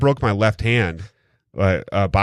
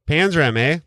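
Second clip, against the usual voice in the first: mock Canadian eh; 7 semitones higher than usual. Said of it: "we use A a lot".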